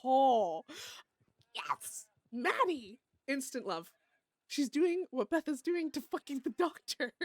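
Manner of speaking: loud, delighted squeal of joy; thrilled. Real, not acted. Streamer delighted by Maddie's telegram, loud thrilled cry. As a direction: gush with instant excitement.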